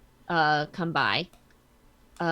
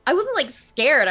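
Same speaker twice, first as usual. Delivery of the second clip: usual speaking voice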